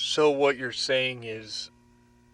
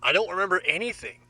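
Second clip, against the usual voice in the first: flustered denial; 6 semitones higher, much faster than usual. Acted. Questioned, panicked denial.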